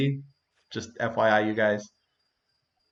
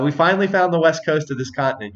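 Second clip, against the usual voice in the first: ordinary delivery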